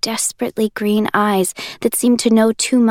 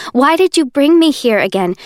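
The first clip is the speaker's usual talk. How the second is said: higher and louder, frantic question; panicked demand; 5 semitones higher, 5 dB louder than usual. Acted. Juliet panicking at Warner in bed, louder.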